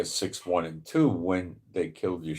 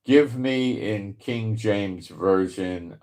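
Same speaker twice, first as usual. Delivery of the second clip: slow and careful for the machine; slow, deliberate command; slower than usual. Real, not acted. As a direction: spell it out slowly to the assistant. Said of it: "no, didn't work".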